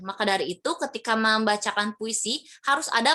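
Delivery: normal talking voice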